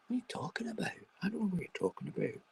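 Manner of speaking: a puzzled high voice, hushed then quick; mock bafflement. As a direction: play dumb in a high hush. Answering himself about latte joke.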